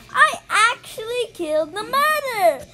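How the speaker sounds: loud excited repeat. Real, not acted. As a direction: say it again, still thrilled.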